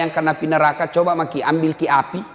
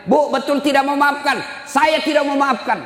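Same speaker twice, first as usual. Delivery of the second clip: high, raised voices of Prophet and mother; acted dialogue; 10 semitones higher than usual. Preacher acting out the Prophet asking the mother. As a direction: ask and refuse like a stern exchange.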